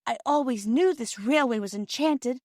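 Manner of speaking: a hint of a delighted girl; awed girl. Phyllis marvelling.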